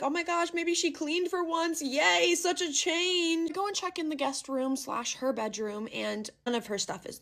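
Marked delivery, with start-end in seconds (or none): 0.0-3.5 mock delighted squeal, pitched higher; happy. Retelling her happy surprise at the clean room, gushing higher. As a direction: gush with relief that the room got cleaned. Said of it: "I'm all happy, like".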